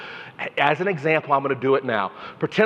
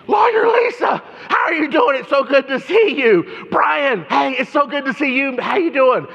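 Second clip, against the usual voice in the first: high, loud, over-the-top hello; cheery greeting; 10 semitones higher, 3 dB louder than usual. Retold or imitated. Old client spotting you, calling out a much higher, louder greeting. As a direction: greet like a long-lost friend.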